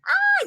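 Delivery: put-on sudden high yelp of a terrified kid; high panicked cry; child's panicked scream. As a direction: shriek like a scared four-year-old. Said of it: "le produjo un pánico".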